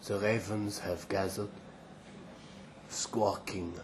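soft, fading near-whisper; faint, dying voice. Acted. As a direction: barely get the words out. Dying elephant writes his diary.

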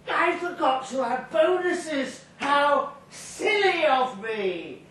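high, slow, mock-innocent drunk voice; mock-silly drunk. Drunk man in a Santa hat feigning silliness to bouncers, high and slow. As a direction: play the fool who forgot his bonuses.

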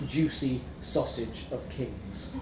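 ordinary delivery, nothing marked

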